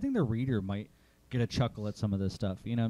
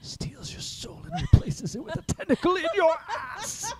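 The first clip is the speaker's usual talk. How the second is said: 1 laughing; 4 dB louder than usual. Real, not acted. Improvised pitch, laughing through.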